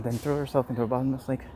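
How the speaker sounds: ordinary delivery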